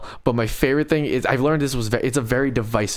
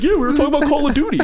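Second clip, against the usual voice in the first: put-on excited kid voice; 5 dB louder, faster than usual. Imitating Jake, laughing.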